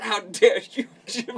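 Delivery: high mock-offended host; fairmaster accent. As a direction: take grand offence in the put-on host voice. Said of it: "this is my accent that I'm gonna do".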